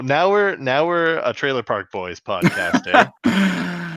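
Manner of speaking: put-on light Canadian accent, lifting on eh; mock Canadian host. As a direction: play the Canadian bit with an eh. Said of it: "my Canadian voice guy".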